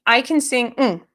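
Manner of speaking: ordinary talk, nothing marked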